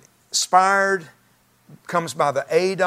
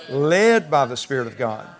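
slow phrase with a big swell on "Led"; emphasized led; slower than usual. Real, not acted. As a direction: stretch "Led" as the answer, slowly. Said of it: "I emphasize the word led".

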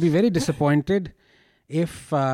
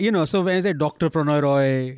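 usual speaking voice

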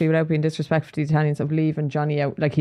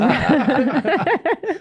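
loud high laugh; cracking up, laughing hard; high burst of laughter; 12 semitones higher, 4 dB louder than usual. Real, not acted. Group laughing at joke.

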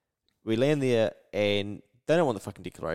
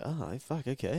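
softer, higher, taken-aback murmur; retold impressed reaction; 3 semitones higher, 7 dB quieter, slower than usual. Listener retelling his reaction to a developer's advice, impressed. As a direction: quietly act out being impressed.

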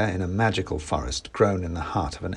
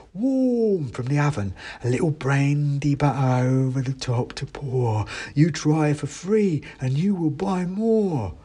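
mouse seller; 5 semitones higher than usual. Acted. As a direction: lure the buyer in sweetly. Mouse voiced.

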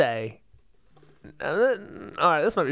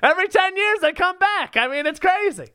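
pitched way up, laughing through the theory; excited giddy rant; 10 semitones higher than usual. Real, not acted. Friend pitching a wild Live Aid theory, high and giddy.

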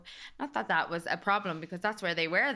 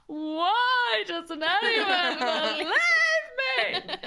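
high, drawn-out comic wail; exasperated mock wail; 12 semitones higher, 5 dB louder, much slower than usual. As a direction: wail it slowly like a misunderstood child. Retold exasperation at mom.

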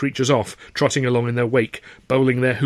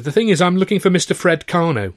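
a touch higher, politely earnest; polite young man; 7 semitones higher, 4 dB louder than usual. Acted. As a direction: introduce yourself politely.

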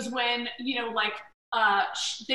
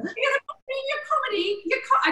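put-on flustered young star fumbling for words; 12 semitones higher, much slower than usual. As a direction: stammer it out in a much higher goofy voice. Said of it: "he goes".

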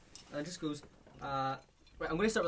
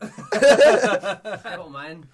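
laughing; 16 dB louder, much slower than usual. Real, not acted. Laughing while showing the bottle.

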